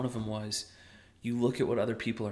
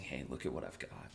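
low, soft, grateful murmur; 9 semitones lower, 12 dB quieter than usual. Retold or imitated. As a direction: murmur it gratefully, much lower. Voicing grateful self.

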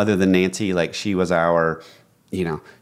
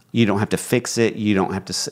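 ordinary delivery

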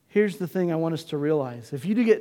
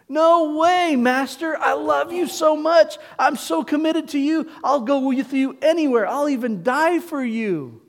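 Peter's pleading promise, much higher and louder; eager vow; 9 semitones higher, 6 dB louder than usual. Acted. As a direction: swear you'll never leave him. Peter vows loyalty to Jesus.

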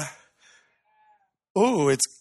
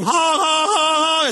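victory honk; 10 semitones higher, slower than usual. Shown as a demonstration. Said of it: "the winning team".

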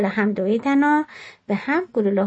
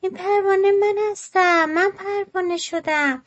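much higher, bright joyful exclamation; joyful cry; 9 semitones higher than usual. A narrator's reading. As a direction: cry out with delight at becoming a butterfly. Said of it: "با خوشحالی فریادی زد، جیغی کشید".

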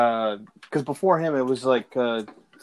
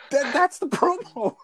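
laughing; 11 semitones higher than usual. Real, not acted. Laughing after the imitation.

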